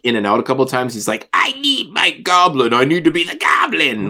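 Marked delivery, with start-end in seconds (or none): 1.3-4.1 Green Goblin. Retold or imitated. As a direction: imitate a villain craving his goblin self. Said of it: "and then the voice fades".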